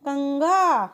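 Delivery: कंघा with the ं sounded through the nose, क़ंघा as kəŋɡa; nasal anusvara demo. Shown as a demonstration. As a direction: say कंघा slowly, letting the ं hum in the nose. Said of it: "तो ऐसे ही उच्चारित किया जाता है". (कंघा को नाक से अनुस्वार की ध्वनि देकर बोलें)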